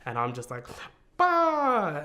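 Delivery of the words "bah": loud, high imitated wail; sudden mock-crying cry, pitched way up; mock bawling. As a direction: burst into a hammed-up bawl. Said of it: "and, and bawling".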